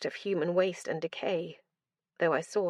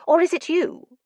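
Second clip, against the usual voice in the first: high excited madman; 10 semitones higher, 7 dB louder than usual. Acted. Madman suspecting.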